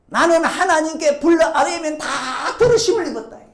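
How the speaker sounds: Job boasting. Acted. Job boasting of answered prayer.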